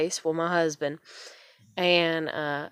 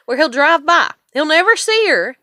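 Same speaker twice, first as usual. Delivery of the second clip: much higher and louder, indignant; real indignation, higher and louder; indignant outburst; 9 semitones higher, 8 dB louder than usual. Real, not acted. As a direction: call out the absent dad. Angry about absent father.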